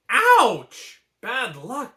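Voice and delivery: sudden loud high yelp of pain; mother crying out. A narrator's reading. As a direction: yelp as she pricks her finger. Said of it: "cried Fa Li at the same time".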